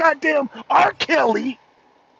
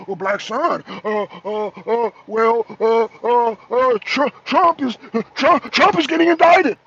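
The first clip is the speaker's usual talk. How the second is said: stammering politicians; 2 semitones lower than usual. Acted. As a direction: stumble through it, then burst out with the news. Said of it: "get up a goddamn arena and talk about".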